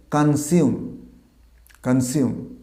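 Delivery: কনজিউম as "kan-sium"; corrected pronunciation. Shown as a demonstration. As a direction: say কনজিউম with an s, not z. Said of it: "উচ্চারণটা হবে". (সঠিক কনজিউম, "স" দিয়ে "কান-সিউম")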